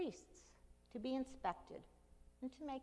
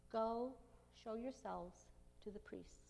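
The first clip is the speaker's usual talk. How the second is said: slow, soft, gentle command; 5 dB quieter than usual. Acted. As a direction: say it gently and slowly. Jesus's words repeated slowly.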